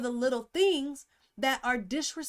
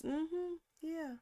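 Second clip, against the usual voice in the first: soft, higher absent-minded murmur; distracted half-listening; 3 semitones higher, 9 dB quieter than usual. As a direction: answer quietly, as if absorbed in your phone.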